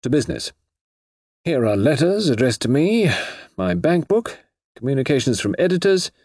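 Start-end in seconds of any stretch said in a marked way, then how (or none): 0.0-0.5 mildly brisk, higher-pitched push onward. A narrator's reading. Suspect getting detectives down to business, mildly brisk. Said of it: "said Mister Eastwood briskly".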